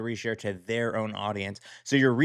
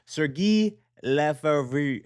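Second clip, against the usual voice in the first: slow, higher mock-French reading of the name; mock French accent; 5 semitones higher, 3 dB louder, much slower than usual. Shown as a demonstration. Host announcing the next designer, in a light French accent. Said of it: "I gave, like, a little French accent".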